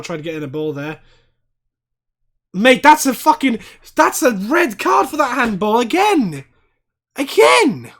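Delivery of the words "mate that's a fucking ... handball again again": loud, high outraged demand for a red; indignant shout. Real, not acted. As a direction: shout it like the ref is blind.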